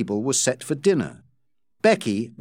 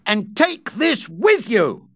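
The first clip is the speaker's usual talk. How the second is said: angry shout; 7 semitones higher, 3 dB louder, slower than usual. Acted. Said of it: "Rawdon shouted".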